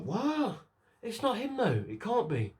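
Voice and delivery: high-pitched, incredulous recalled reaction; mock disbelief. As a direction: squeak out your disbelief at the twist. Retelling own disbelief at the twist.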